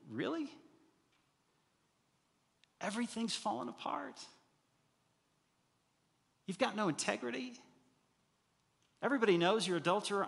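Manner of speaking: high, incredulous jeer; mocking doubter. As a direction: scoff at David from far above, then rush. Voicing a doubting accuser.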